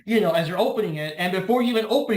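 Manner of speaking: ordinary delivery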